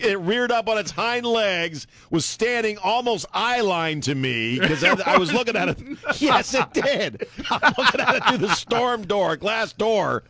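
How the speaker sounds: high, emphatic retelling; excited insistence. Real, not acted. Storyteller defending his raccoon tale to laughing co-hosts, high and emphatic. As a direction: press each detail on the doubters.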